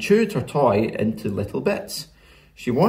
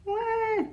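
put-on soft, high baby seal wail; small, faraway wah; distant seal cry; 7 dB quieter than usual. A narrator's reading. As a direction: cry faintly, as if far away. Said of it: "far away there came a".

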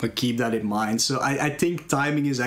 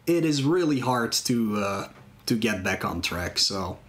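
ordinary delivery

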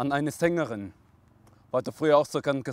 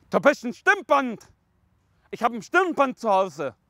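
higher-pitched playful outburst; silly exclaiming; 7 semitones higher, 4 dB louder than usual. Real, not acted. Joking to father about vocal cords, silly exclaiming. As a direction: escalate the nonsense gleefully. Silly escalating wordplay to listener.

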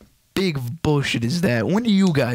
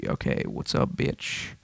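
much lower, softer, voiceless growl; tough mock greeting; 12 semitones lower, 7 dB quieter than usual. Gamer greeting the screen in a low, soft tough-guy voice.

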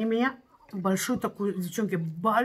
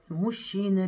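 normal talking voice

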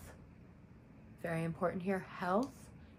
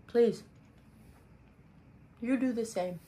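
talking while chewing ice; 3 semitones higher, 4 dB louder than usual. Real, not acted. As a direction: speak around a mouthful of ice. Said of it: "Excuse me, I was chewing ice".

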